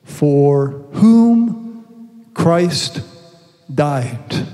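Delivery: low, slow, weighty last words; solemn emphasis. Shown as a demonstration. Preacher stressing the last words of the verse, low and solemn. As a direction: let each word land heavy. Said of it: "The last four words".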